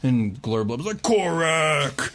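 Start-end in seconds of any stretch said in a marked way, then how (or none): 1.0-2.1 hearty greeting. Acted. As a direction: call out to an old buddy, hammed up. Bugbear greets old friend.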